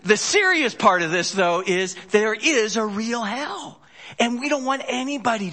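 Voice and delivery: fervent preacher pressing the point home; full-force preaching. Acted. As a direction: preach it hard to the room.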